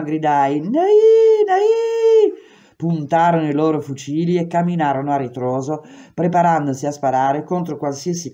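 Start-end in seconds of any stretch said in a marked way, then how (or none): 0.6-2.4 very high, loud "Nai, nai!" bird screech; higher bird call. Retold or imitated. Prisoner frightening armed cops at night, very high bird cry. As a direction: pitch it even higher than a bird. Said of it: "con una voce più acuta di prima".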